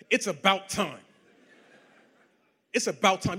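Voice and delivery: boastful, half-laughing; gloating boast. Retold or imitated. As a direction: savour the brag with a laugh. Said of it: "Everything in me wanted to say".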